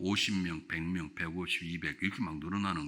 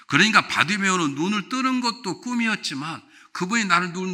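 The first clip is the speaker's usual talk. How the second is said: loud, high, ringing preacher's call; fervent pulpit voice at full volume; full-force preaching; 10 semitones higher, 9 dB louder than usual. Acted. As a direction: preach it out to the whole hall.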